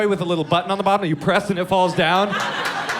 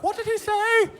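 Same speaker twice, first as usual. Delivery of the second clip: much higher, squeaky mock Irish puzzlement. Comic mimicking how the shocked Irish crowd sounds to him, in a mock Irish accent. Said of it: "that's how Irish people sound to me".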